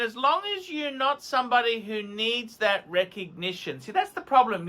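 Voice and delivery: ordinary delivery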